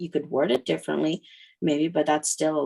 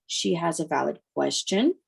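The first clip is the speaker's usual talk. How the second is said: ordinary delivery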